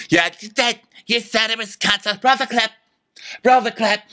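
high, insistent mock voice; Tamara. Demanding proof from Dorinda, high mock voice. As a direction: insist in a high, squawking mimicry. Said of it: "And Tamara goes".

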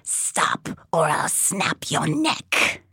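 rasping whispered threat; cold hissing layered whisper. Acted. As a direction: hiss it into her ear. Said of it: "A rasping voice sounded in my ear".

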